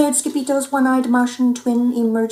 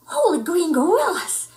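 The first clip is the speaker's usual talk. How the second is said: astonished gasp; 8 semitones higher than usual. Acted. Pup spotting a creature, high astonished cry. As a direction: blurt it out in shock. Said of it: "gulped Scipito".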